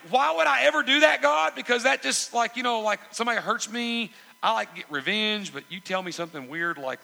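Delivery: high, loud objection easing as it goes; mock protesting believer. Believer objecting to God's weird commands, mock indignant protest. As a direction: argue back in hammed-up disbelief, starting at full volume.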